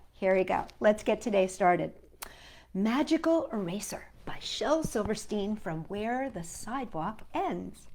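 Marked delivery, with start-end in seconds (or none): 2.8-7.9 whispered title. Acted. Hushed title reading to kids.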